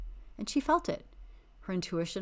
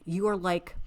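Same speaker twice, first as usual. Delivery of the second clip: ordinary talk, nothing marked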